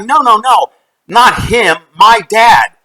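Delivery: high, loud, emphatic denial; imitating an adamant, confused mother; insistent correction. Acted mother insisting on another dad.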